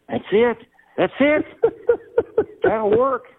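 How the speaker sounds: high-pitched retold outburst of discovery; excited eureka. Storyteller acting out finding his catchphrase on the radio, excited. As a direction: act out hitting on the answer, voice leaping up.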